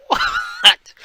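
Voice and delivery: high, loud, airy "what?"; incredulous. Retold or imitated. Fan reacting to mom's blasé reply, incredulous.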